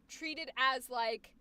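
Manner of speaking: ordinary talk, nothing marked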